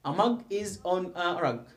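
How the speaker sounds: very slow, drawn out; slow model reading. Shown as a demonstration. Teacher modelling the sentence for students slowly. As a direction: read it out slowly. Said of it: "teacher models sentence slowly".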